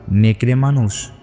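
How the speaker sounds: ordinary delivery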